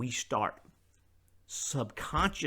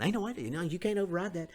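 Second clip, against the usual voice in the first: doubting listener; 8 semitones higher, faster than usual. Retold or imitated. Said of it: "people listening to my voice right now are going".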